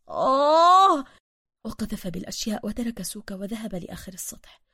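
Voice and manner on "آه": loud, high "aah" of frustration; long frustrated wail; exasperated cry. Acted. Bruce throwing the things down, crying out in frustration.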